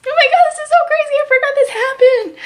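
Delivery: very high, rushed squeak; real high-pitched disbelief; overcome disbelief. Real, not acted. Overcome while recalling the story.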